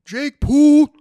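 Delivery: loud, high, drawn-out fighter callout; mock challenge shout. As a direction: bellow a mock threat at the rival. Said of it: "What the fuck was that?".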